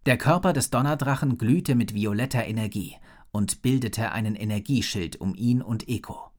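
ordinary delivery

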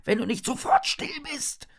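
high, sharp warning; Hannah, hissing. A narrator's reading. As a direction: hush a friend sharply. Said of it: "zischt Hannah". (scharf gezischte Warnung)

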